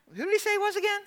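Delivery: high-pitched confused aside; puzzled bystander. Retold or imitated. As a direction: ask your neighbour in a small, high voice. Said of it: "they were asking the people beside him".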